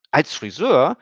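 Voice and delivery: put-on high disbelieving girl's question; incredulous. A narrator's reading. Emmy doubts Stefan's job, incredulous high girl's voice. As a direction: ask in disbelief. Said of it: "fragte Emmy ungläubig".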